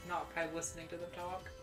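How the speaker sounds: ordinary delivery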